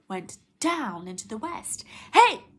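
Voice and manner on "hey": high furious yell; angry scream. Acted. Coyote screaming up at the sun, furious. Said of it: "Coyote screamed".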